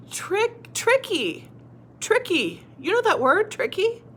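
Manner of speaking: TRICKY high and quick, as a discovery; excited recognition. Shown as a demonstration. Teacher asking if you know that word, excited.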